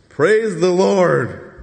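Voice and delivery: drawn-out, loud, high praise; joyful praise. Retold or imitated. Freed Zechariah praising God, slow, loud and high. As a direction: let the praise burst out slowly.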